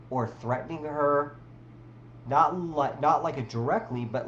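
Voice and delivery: ordinary talk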